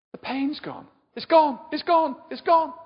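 high, very loud Brummie cry, building; excited Brummie. Retold or imitated. Healed young woman crying out her pain is gone, loud mock Brummie. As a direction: shout it out in an overdone Birmingham accent. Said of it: "I can't do Brummie".

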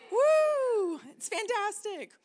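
high joyful whoop, then a delighted rush; excited whoop. Real, not acted. Preacher whooping to the church about her coming grandchild, thrilled.

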